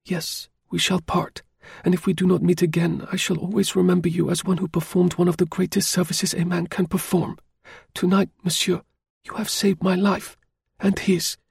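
put-on a young woman thanking her rescuer gravely; grateful French lady, solemn. A narrator's reading. Lady telling the driver he saved her life, solemn.

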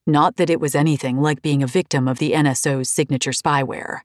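ordinary delivery, nothing marked

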